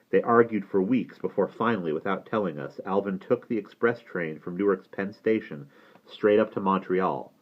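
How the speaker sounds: usual speaking voice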